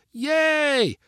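very high, drawn-out yay; cheering kids. A narrator's reading. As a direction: cheer like delighted children, without much volume. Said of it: "cried the four kids".

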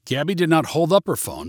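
plain reading voice

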